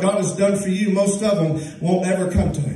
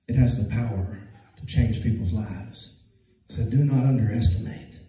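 softer, lower, intimate sermon voice; quiet reflective preaching; 9 semitones lower, 5 dB quieter than usual. Acted. Preacher quietly urging listeners to share their story, hushed. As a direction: pull them in close and speak gently.

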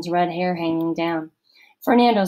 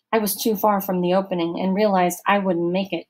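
ordinary delivery

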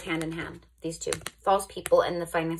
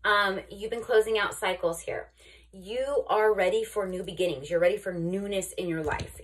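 ordinary talk

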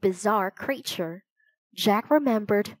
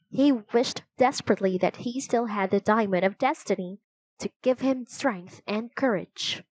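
ordinary delivery

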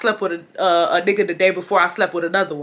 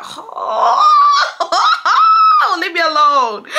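very high, loud wail of protest; hammed-up whine; 12 semitones higher, 5 dB louder, slower than usual. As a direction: wail it like a dramatic tantrum. Exaggerated protest at being called.

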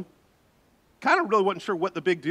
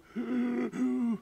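gasp for air; 7 semitones higher, 6 dB quieter than usual. Retold or imitated. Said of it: "I'm out of breath".

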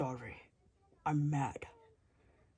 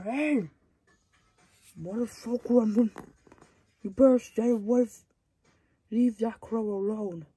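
loud, raised yell at an attacker; angry shout; 5 semitones higher, 6 dB louder than usual. Acted. Hero warning the attacker to leave the crow alone, shouting angrily. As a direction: bark a warning to back off.

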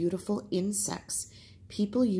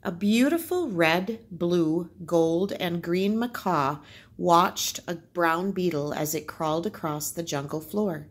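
put-on lively read-aloud for children; story narration; 7 dB louder than usual. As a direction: tell the tale to the class, bright and clear. Teacher reads folk tale aloud.

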